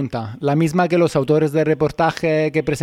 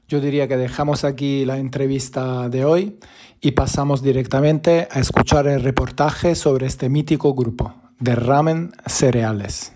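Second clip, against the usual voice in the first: ordinary talk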